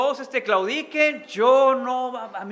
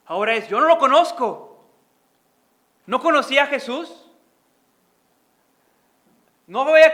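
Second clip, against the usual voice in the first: loud, driving sermon voice; full-force preaching; 3 semitones higher, 10 dB louder than usual. Acted. Pastor retells Peter's denials in a loud, preaching voice. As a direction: preach it out to the congregation.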